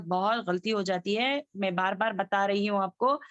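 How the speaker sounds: ordinary talk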